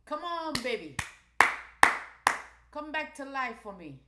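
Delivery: high, loud coaxing mama voice; pleading mama. Pretend. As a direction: beg the chart like a mother to her baby. Coaxing the chart back to life.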